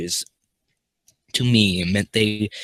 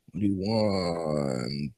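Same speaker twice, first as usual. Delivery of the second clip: drawn out; 5 semitones lower, 8 dB quieter, much slower than usual. Pretend. Silly drawn-out aside between takes.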